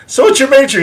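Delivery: high cheery question. Retold or imitated. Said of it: "I'm like".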